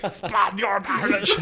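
put-on high, slow parody of muddled accents; mock Spanish-Irish accent. Teasing a friend's accent mix, mock Spanish-Irish. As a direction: mimic a bad Spanish-Irish blend. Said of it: "I am Spaniard and Irish".

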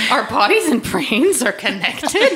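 mock surprise. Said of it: "mock surprise".

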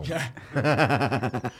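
loud, low staccato laugh; big belly laugh at a joke; hearty laugh. Real, not acted. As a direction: burst out in a long rolling laugh. Reaction to the tattoo joke.

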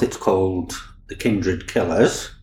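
normal talking voice

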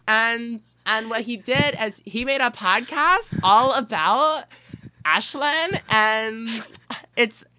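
put-on high, slow teasing girly voice; girl impression. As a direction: mimic giggly little sisters, drawn out. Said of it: "all of my sister".

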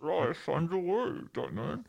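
muffled by hand. Shown as a demonstration. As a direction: hand over mouth, muffled and soft. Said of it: "do it with your hand over here".

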